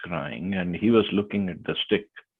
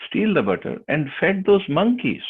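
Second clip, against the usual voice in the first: angry scolding; 9 semitones higher, 3 dB louder than usual. Retold or imitated. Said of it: "Mother Yashoda more angry".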